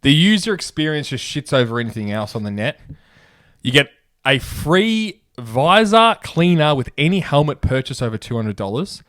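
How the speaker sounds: loud, punchy radio ad read. Acted. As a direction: sell it like a hype ad. Reads sponsor ad.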